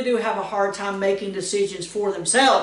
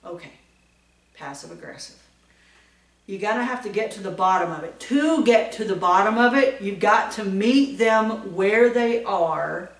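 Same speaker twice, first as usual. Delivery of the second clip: usual speaking voice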